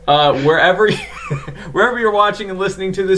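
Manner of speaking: laughing through it. Real, not acted. Laughing at his own bad sentence.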